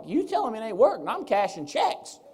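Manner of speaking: high, loud cocky comeback; mock-incredulous brag; cocky retort. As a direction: throw the doubt back at them, crowing. Acting out retort to doubters.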